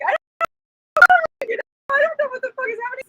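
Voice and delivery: high, rushed retold panic; high frantic babble; panicked. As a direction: blurt it out fast in terror. Choking, retelling her panic.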